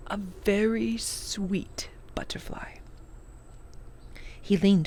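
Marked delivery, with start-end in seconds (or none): 0.1-2.8 softly whispered, slow and fond; tender whisper. Acted. Old professor recalling his late wife, slow tender whisper. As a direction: breathe it out, lost in memory of her.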